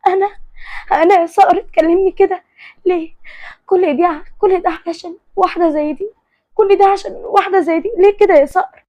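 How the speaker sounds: high-pitched acted weeping protest; distraught, repeating as if crying; tearful breakdown. A narrator's reading. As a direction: wail in hurt disbelief. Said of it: "حياة بدموع وانهيار جامد".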